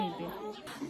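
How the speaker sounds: यहीं पे breathed out soft and voiceless, almost a whisper; whispered repeat. Retold or imitated. Repeating to the conductor where to stop, whispered. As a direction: let यहीं पे fall away into a breathy whisper.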